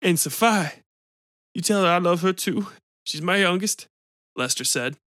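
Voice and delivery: lightly voiced pleading man in a stall; a hint of a raspy smoker begging; Lester, stuck man. A narrator's reading.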